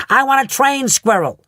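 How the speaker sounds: high, loud spoiled shout; insist, stamping the words; bratty demand. A narrator's reading.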